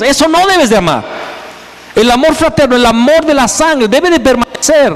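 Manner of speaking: preaching at full force. Acted.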